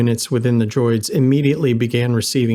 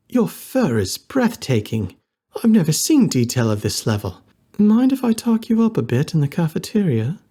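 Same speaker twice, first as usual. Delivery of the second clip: put-on gushing fox girl compliment, rising high; lightly voiced admirer; admiring fox girl; 5 semitones higher than usual. A narrator's reading. Henna admires Lena's fur.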